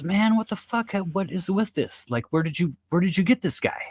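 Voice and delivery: high and bewildered; amazed bartender. Acted. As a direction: bartender asks in disbelief.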